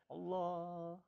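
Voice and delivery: a hushed, tender Allah; soft, hushed dhikr. Shown as a demonstration. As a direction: breathe Allah gently under the voice. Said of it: "kısık sesle".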